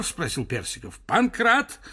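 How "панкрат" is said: high loud yell for the servant; shouted call. Acted. Professor yells for Pankrat again.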